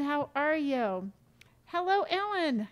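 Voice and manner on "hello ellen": ordinary talk, nothing marked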